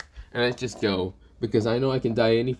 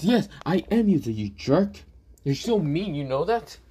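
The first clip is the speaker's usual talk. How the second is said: put-on lightly raised girl's voice, a little hurt; 6 semitones higher than usual. Yumi scolding Issei for being mean, lightly higher girl voice. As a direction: pitch up a bit to play an offended girl.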